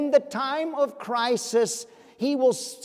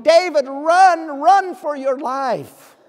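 urgent, slow, loud call to flee; shouting; 6 semitones higher, 9 dB louder, slower than usual. Retold or imitated. Inner voice of fear shouts at David to run, loud and slow. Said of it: "the voice was shouting".

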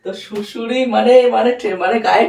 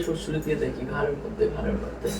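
fading into a sneaky whisper; creeping whisper; 7 semitones lower, 12 dB quieter than usual. Pretend. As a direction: hush down as you creep in. Creeping to tickle neck.

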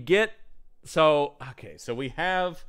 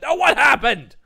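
loud, high stern demand; 11 semitones higher, 9 dB louder than usual. Retold or imitated. Van Helsing slapping the maid, loud stern demand. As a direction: snap it at her. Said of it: "He goes".

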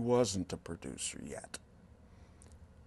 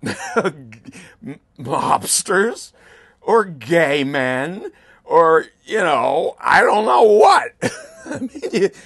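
louder and louder, laughing, higher at the end; laughing shout; 6 semitones higher, 17 dB louder, much slower than usual. Real, not acted. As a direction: burst out laughing as the guesses grow absurd. Mocking speculation ends in laughter.

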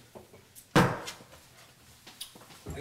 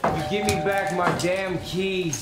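put-on raised, forceful drunken insistence; angry demand; 3 semitones higher, 4 dB louder than usual. Drunk husband demanding car keys from wife, angry and forceful. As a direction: order her to hand them over.

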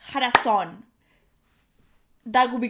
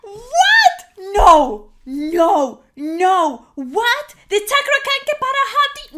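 loud, high, stunned cries of What; shocked disbelief; 7 semitones higher, 10 dB louder, slower than usual. Real, not acted. Reacting vlogger hears the song choice, real loud shock.

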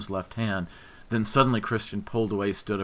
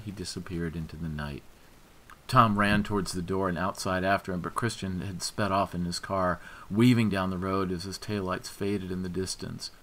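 usual speaking voice

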